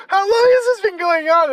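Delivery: high, loud shocked question; mock-appalled disbelief. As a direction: cry it out appalled. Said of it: "he was like".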